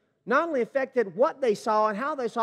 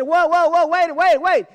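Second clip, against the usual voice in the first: loud, high whoa, wait; 8 semitones higher, 11 dB louder than usual. Retold or imitated. Caleb hushing the people before Moses, a loud high call. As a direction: shout the crowd down to silence.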